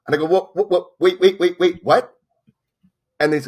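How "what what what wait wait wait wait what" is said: lightly mock-panicked stammer, higher; flustered disbelief. Caller stunned by producer's call, flustered surprise. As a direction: stumble over the words in shock.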